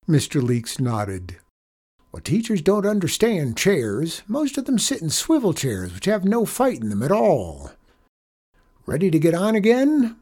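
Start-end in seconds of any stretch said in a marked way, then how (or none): 2.1-10.2 put-on high, animated old-hand voice; cowboy janitor, animated. A narrator's reading. Janitor explaining chairs to the boy, animated. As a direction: explain it like a seasoned chair wrangler.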